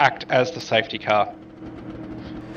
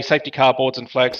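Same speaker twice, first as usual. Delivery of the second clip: ordinary talk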